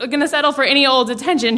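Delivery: ordinary delivery, nothing marked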